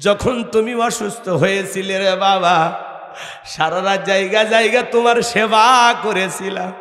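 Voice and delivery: a grieving mother cries out her son's name in a half-sung lament; weeping mother. Acted. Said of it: "কাঁদে আর বলে". (কান্নাভেজা সুরে মায়ের বিলাপ)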